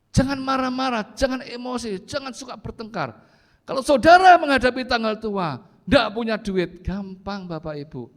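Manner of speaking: high, loud exhortation; forceful preaching. Real, not acted. Preacher urging congregation not to quarrel when money runs short, forceful preaching. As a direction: drive each warning home, high and loud.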